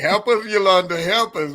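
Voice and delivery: high, loud, hammed-up plea for help; mock plea. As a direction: beg for help, playfully. Joking plea to Yolanda.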